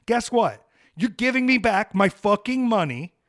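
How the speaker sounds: angry customer laying down the law; defiant shout. Real, not acted. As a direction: hurl it at the bank.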